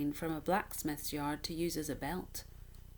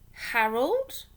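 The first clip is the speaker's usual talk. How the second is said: surprised woman; 6 semitones higher, 8 dB louder than usual. Acted. Passing woman voiced in story.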